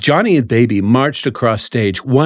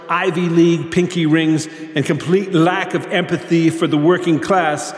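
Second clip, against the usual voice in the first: higher, swaggering greaser speech; Johnny voice; 8 semitones higher than usual. Acted. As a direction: speak as a cocky greaser.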